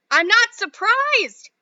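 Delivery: loud, slow, high retort; father scolds his swelling daughter; exasperated father. Acted. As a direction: snap at her. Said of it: "said Mister Beauregard".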